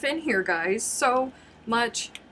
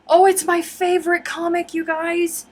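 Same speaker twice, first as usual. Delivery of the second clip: high, loud and thrilled; gushing with delight; excited; 8 semitones higher, 7 dB louder than usual. Real, not acted. Excited about favorite comic.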